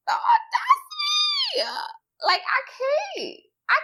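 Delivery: very high, laugh-choked squeal; helpless laughter. Real, not acted. Overcome by the audacity of a retold line, helpless laughter. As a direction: squeal it out while cracking up for real. Cracking up over the quote.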